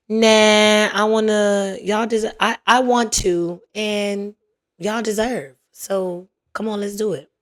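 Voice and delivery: normal talking voice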